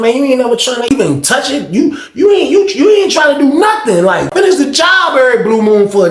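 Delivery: pushing her hard, voice climbing into a shout; heated retold complaint.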